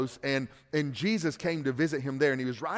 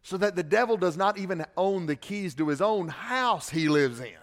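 usual speaking voice

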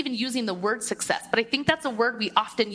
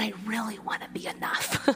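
whispered confession; 6 dB quieter than usual. Real, not acted. Confessing a private wish.

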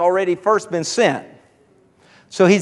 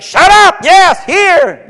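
a hammed-up exasperated snap, louder and higher; mock angry God; 9 semitones higher, 8 dB louder, much slower than usual. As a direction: play God losing patience and barking at the whiner. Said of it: "he's like".

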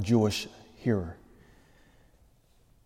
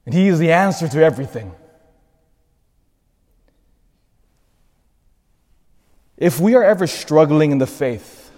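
loud, driving exhortation; preaching; 5 semitones higher, 7 dB louder than usual. Real, not acted. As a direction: push the congregation with urgency. Fervent exhortation.

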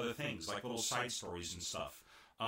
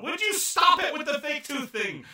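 high, loud exasperated yell; princess yelling; 12 dB louder than usual. Retold or imitated. Princess yelling at butlers about the fake tooth. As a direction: shriek it at the teasing butlers. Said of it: "princess yelling at the butlers".

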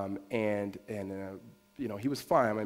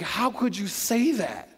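high, drawn-out protest; acted indignant disbelief; 7 semitones higher, slower than usual. Parent answering a child's accusation, acted indignant disbelief. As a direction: stretch it out, pitched up, as if wounded.

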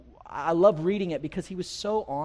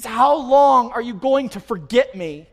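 louder, higher, slow pleading; crying out to God; 4 semitones higher, 7 dB louder, slower than usual. Acted. Psalmist to God in his hopelessness, crying out. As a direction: plead upward, stretching the question out. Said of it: "he's just crying out to God in his hopelessness".

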